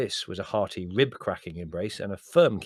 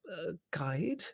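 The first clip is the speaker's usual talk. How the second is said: high, soft, rising question; feeble; 10 semitones higher, 6 dB quieter than usual. A narrator's reading. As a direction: echo it faintly, at a loss. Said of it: "said Mother feebly".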